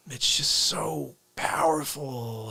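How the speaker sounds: slow, breathless whisper. Real, not acted. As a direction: hush it in awe.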